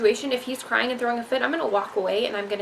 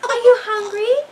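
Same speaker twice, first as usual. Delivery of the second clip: much higher, louder and slow, with a laugh; 13 semitones higher, 9 dB louder than usual. Real, not acted. Mom cooing at her baby.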